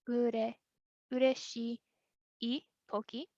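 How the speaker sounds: "ureshii" drawn out, long pause, then "toki"; slow breakdown. Shown as a demonstration. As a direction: split it clearly: "ureshii" ... "toki". Teacher breaks the form down.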